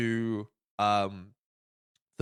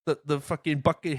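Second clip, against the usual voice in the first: normal talking voice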